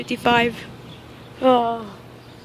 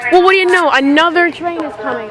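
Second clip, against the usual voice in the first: excited; 6 semitones higher, 5 dB louder than usual. Real, not acted. Excited by another train.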